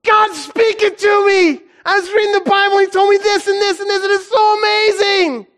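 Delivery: high, loud, elated retelling; overjoyed kid. Retold or imitated. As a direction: gush it out in wonder. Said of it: "He said".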